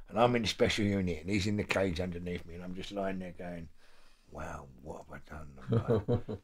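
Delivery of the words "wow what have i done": hushed, low inner voice; quiet muttering. Retold or imitated. Inner thought, lying in cell.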